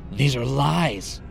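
high, drawn-out cry of defiance; shouting protest. A narrator's reading. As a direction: call out the accusation without full volume. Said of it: "found himself shouting aloud".

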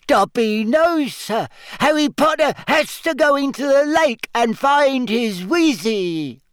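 high squeaky elf voice; squeaky house-elf. A narrator's reading. Dobby voice.

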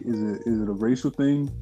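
ordinary talk

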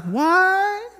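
high-pitched imitation of her disbelief; astonished. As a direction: squeal it as her startled question. Retells her astonished reaction.

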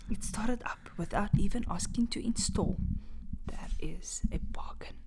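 hushed spooky storytelling; dramatic whisper. Acted. Reading a horror story aloud to friends, whispered. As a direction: whisper it to give chills.

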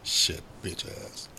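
soft, whispered, slow grumble; hushed grumbling aside; muttered curse. Real, not acted. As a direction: mutter it under the breath.

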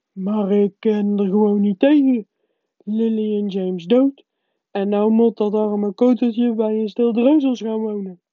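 acted bawling, like a big man overcome by grief; sobbing giant. A narrator's reading. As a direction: wail it through hammed-up sobs. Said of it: "snikte Hagrid".

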